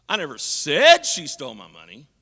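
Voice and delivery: said punched loud with a rise; stress on said. Shown as a demonstration. Preacher demos inflection to the congregation, stressing said. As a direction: hit the word said hard. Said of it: "which word I put the voice inflection on".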